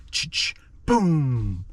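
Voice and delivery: a mouth-made ch-ch pump then boom; imitated shotgun. Acting out villagers shooting the creatures, shotgun noise. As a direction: sound out the shotgun blast.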